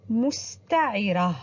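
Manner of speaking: مستعرة spoken slowly, syllable by syllable; drawn-out teaching pronunciation of مستعرة; مستعرة with the final ta marbuta read in full as -ra; slow model reading. Shown as a demonstration. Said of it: "هيا معي".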